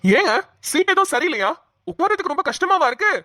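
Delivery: joking. Acted. Auto driver teasing the worried passenger, joking. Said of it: "comedy பண்ணிக்கிட்டு இருக்க".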